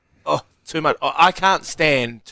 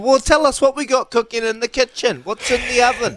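chirpy host voice; 12 semitones higher than usual. Pretend. Pretend cooking show host.

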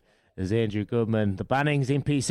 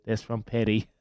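ordinary talk, nothing marked

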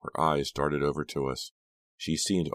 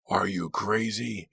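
mock scream; 6 semitones higher, slower than usual. A narrator's reading. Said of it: "he screamed at Ava".

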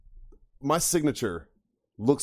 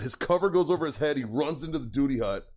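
usual speaking voice

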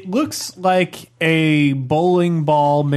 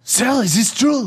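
higher, moved French question; French accent; 8 semitones higher than usual. Acted. Bomb finding a kindred spirit, higher hopeful French accent. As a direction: ask hopefully in raised mock French. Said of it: "It's got eyes and a French accent".

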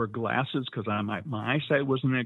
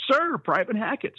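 recruit sound-off; 11 semitones higher than usual. Shown as a demonstration. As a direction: snap off your name like a recruit. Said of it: "you gotta go".

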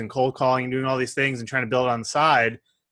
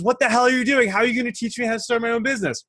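outraged yell; 10 semitones higher, faster than usual. Acted. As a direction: yell it at the monk who half drowned you. Student furious after second dunk.

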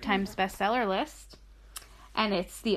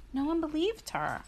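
usual speaking voice

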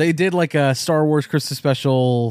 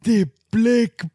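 halting, drawn-out actor impression; Forest Whitaker; 4 semitones higher, much slower than usual. Repeating his impression on request, halting Forest Whitaker voice. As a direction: break the phrase in two for comic weight. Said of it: "Do it again with the eye".

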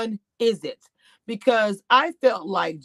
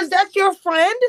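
retold question; 9 semitones higher, 4 dB louder than usual. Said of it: "I said".

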